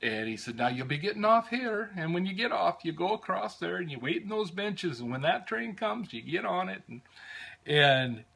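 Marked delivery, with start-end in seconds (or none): 0.6-6.8 higher-pitched imitation of a helpful Irish conductor's brogue. Conductor showing Americans where to change trains, Irish brogue. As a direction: guide the tourists kindly in an Irish accent. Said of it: "lovely brogue that I have".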